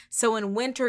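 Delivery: ordinary talk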